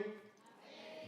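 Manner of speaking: ordinary delivery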